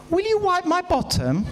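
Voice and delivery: put-on a toddler calling out in the night. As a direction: squeak it like a small child. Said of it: "it goes something like this".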